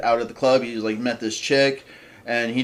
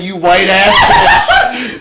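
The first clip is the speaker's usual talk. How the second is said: high, loud, drawn-out insult through laughter; mock angry shout; 6 semitones higher, 8 dB louder, much slower than usual. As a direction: holler it as the imagined fry guy. Imagined fry guy's insult.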